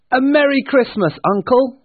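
high, loud, jolly greeting; cheerful cry. Acted. Nephew greeting his uncle at Christmas, cheerful. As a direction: call out merrily at high pitch. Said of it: "cried a cheerful voice".

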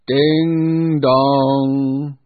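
long ringing ding dong like a church bell; bell chime. A narrator's reading. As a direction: chime it out loud and slow.

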